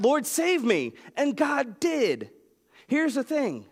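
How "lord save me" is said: cry for help. Retold or imitated. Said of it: "Jonah cried out".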